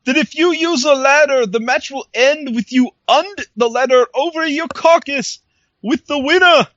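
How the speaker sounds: a hint of his grandiose prophet voice; Broken Matt impression. Retelling the Broken Matt promo to his co-host, lightly theatrical. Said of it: "Broken Matt said".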